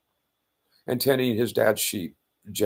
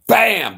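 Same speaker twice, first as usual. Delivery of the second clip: sharp shouted gunshot bang; loud mouth-made shotgun bang; gunshot noise; 11 semitones higher, 10 dB louder than usual. Retold or imitated. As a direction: bark it out like a shotgun blast. Shotgun blast imitated.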